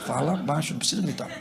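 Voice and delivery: low, soft shushing husband; hushed husband. Acted. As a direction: quietly urge her to keep it down. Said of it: "aí o marido fala assim".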